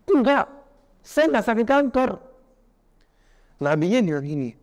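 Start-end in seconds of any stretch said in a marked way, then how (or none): 0.1-2.1 high, loud, slow protest in a put-on voice; indignant denial. Sick man snapping back at the prophet, high and loud. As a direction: bristle and flatly refuse the diagnosis.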